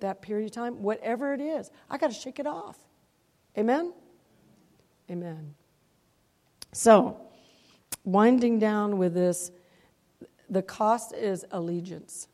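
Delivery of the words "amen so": ordinary talk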